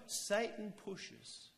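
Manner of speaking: usual speaking voice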